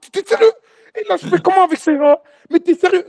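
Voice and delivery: high, loud furious disbelief; outraged. Retold or imitated. Guide grabbing the brother by the neck, shrieking high and loud.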